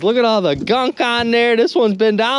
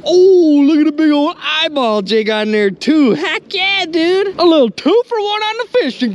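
higher-pitched, thrilled exclamation; excited; 6 semitones higher than usual. Real, not acted. Angler showing viewers a bonus find, excited. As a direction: cheer at a lucky find.

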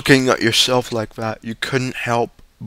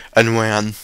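ordinary delivery, nothing marked

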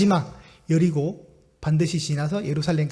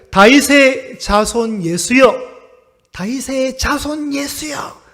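slow, drawn-out plea, louder at first; pleading cry; 4 semitones higher, 4 dB louder, much slower than usual. Retold or imitated. Blind beggar crying out slowly to Jesus for mercy. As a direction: cry out for mercy, stretching each word. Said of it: "외칩니다".

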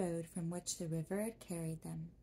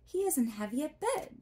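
put-on eager girl, pitched up and louder; 7 semitones higher, 6 dB louder than usual. A narrator's reading. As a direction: rush to reassure the stork. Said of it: "said Dorothy eagerly".

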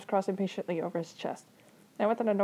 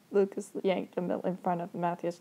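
plain reading voice

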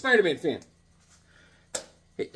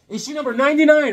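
cover blurb; 9 semitones higher, 6 dB louder, faster than usual. Pretend. Dramatic comic cover reading.

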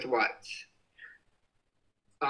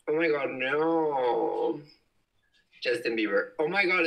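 ordinary delivery

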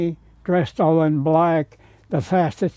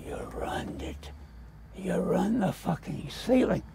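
breathy whispered accusation, very soft; 11 dB quieter than usual. Retold or imitated. Artist whispering to the curator on the scaffold, hushed. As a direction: hiss it so nobody below hears. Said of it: "I whispered to him".

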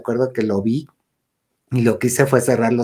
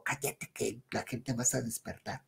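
very soft warning; retold hushed voice; 17 dB quieter than usual. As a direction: shush someone without waking the house. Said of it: "ella hablaba bajito".

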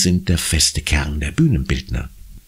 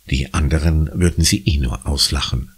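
ordinary delivery, nothing marked

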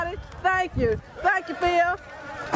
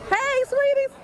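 calling out; 13 semitones higher, 3 dB louder than usual. Real, not acted. Greeting children marching past.

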